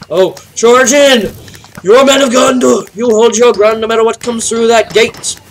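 high, loud heroic war cry; rally the defenders like a general; commander rallying troops. Acted. Gamer plays a general shouting orders at his army.

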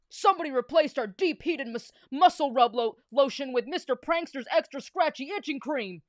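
loud, forceful complaint; outraged coach at full volume; coach shouting. A narrator's reading. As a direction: holler it across the field like a coach. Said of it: "shouted the coach".

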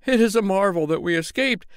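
outdo his friend's details; eager cry. Acted. Tailor adds details for the crowd, high and dramatic. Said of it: "cried the tailor".

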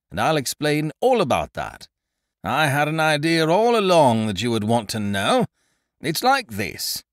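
a jolly bluff chap chattering on; hearty Ukridge. A narrator's reading. Ukridge telling his friend to remember a tune, hearty.

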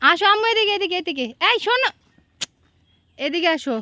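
yelling across the room. Real, not acted. Mother calling child over. (দূর থেকে ডাকা)

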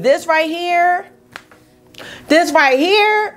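high, slow, rising emphasis; excited. Real, not acted. Vlogger showing off her blue look, excited emphasis. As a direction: show off the look proudly.

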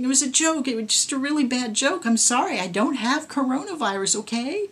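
high, pleading panic; panicking. A narrator's reading. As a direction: beg your way out in a rising panic.

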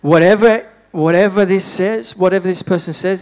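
preaching shout. Real, not acted. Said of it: "preaching climax".

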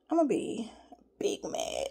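low, breathy mock-menacing whisper; whispered threat. Real, not acted. Vlogger whispering a playful threat to doubters. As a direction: hush it like a joking warning.

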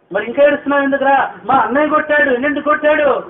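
put-on high, loud and fast, pressing the boy; retold exchange, high and loud; loud retold questioning. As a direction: raise your voice like a neighbour scolding. Preacher and crying boy exchange.

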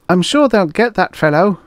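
high, loud underling voice; reassuring assistant. A narrator's reading. Assistant reassures the producer, high-voiced. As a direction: pitch it up to placate the boss.

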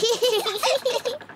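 a high-pitched burst of laughter. Acted. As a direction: let out a squeaky laugh. Grandpa laughs during rivalry. (ऊँची आवाज़ में हँसी)

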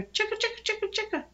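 high, rapid-fire squirrel chatter. A narrator's reading. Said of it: "shouted at her".

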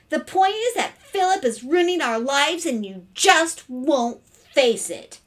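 high, loud accusation; indignant daughter. A narrator's reading. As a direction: hit each charge with exasperation.

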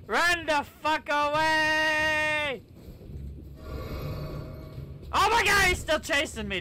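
frantic high yell while fleeing; yell, running for your life; being chased. Real, not acted.

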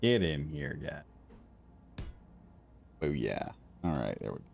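ordinary delivery

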